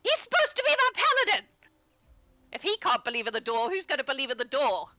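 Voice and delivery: high, fast exasperation; exasperated outburst. Acted. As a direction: throw up your hands in disbelief. NPC exasperated at paladin.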